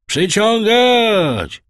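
high, loud, drawn-out call; called-out order. A narrator's reading. Edmund commands the sailors to haul, loud call. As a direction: call it out to the whole crew. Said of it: "zawołał Edmund".

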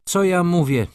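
mildly embarrassed exclamation; flustered. A narrator's reading. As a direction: catch yourself in confusion, pitch jumping up. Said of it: "Pani baronowa zmieszała się".

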